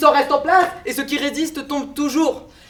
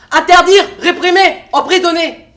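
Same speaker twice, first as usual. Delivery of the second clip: hammered-out words, raised pitch, first one loudest; forceful listing; 4 semitones higher, 5 dB louder than usual. Acted. Sceptic lists the regime's methods, hammering each word. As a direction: hit each word hard, separately.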